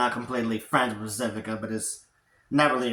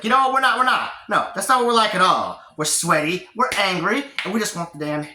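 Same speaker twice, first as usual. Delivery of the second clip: high, loud indignant outburst; indignant protest; 7 semitones higher, 5 dB louder than usual. Acted. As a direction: protest loudly, pitch jumping up. Unicorns protest in acted retelling.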